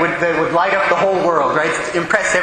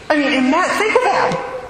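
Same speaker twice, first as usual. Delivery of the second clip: marvelling; 11 semitones higher than usual. Real, not acted. Exclaims to congregation.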